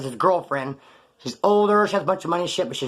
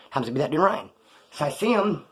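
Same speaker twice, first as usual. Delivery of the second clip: usual speaking voice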